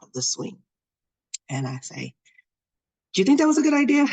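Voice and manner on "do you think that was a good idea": loud, high-pitched telling-off; retold scolding. Mom to daughter just freed from the swing, going off. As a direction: fire it off as an exasperated mom. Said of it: "I'm, like, going off".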